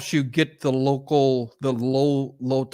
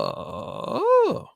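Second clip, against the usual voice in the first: high falsetto oh. Shown as a demonstration. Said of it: "go up as high as you can".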